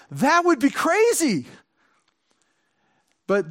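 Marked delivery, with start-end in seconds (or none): 0.1-1.5 high, loud incredulous outburst; a listener scoffing at the scene; mock disbelief. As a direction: toss it off like it's unthinkable. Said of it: "And you say".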